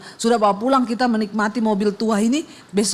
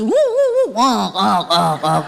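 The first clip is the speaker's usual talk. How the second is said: sputtering engine; slower than usual. Retold or imitated. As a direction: make the old car choke and stall. Old car stalls on the hill. (mesin mobil tua tersendat lalu mati)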